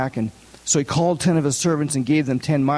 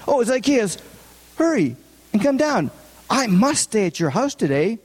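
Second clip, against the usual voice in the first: Jesus calling; 7 semitones higher than usual. Acted. Jesus voiced calling up tree.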